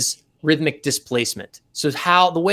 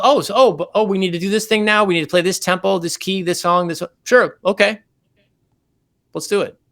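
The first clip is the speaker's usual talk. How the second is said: mock flustered rush; 5 semitones higher than usual. Acting out a sudden gig request.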